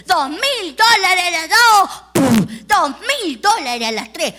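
slow, loud hammer count; auctioneer's countdown. Retold or imitated. Auctioneer closes the sale, slow and loud. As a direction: knock each call down with the gavel. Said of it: "El del martillito".

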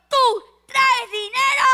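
slow, loud threatening demand; menacing thug. Acted. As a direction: lean in like a bully. Thugs demand money.

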